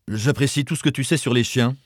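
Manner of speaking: understated sarcastic scoff; sneering. A narrator's reading. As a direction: sneer lightly at a meddling sister. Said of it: "ricana son frère".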